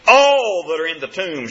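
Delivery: "all" held long and hit hard; preacher's heavy emphasis on "all"; stress on all. Real, not acted. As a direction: lean on the word "all", drawing it out. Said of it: "tried to emphasize that word all".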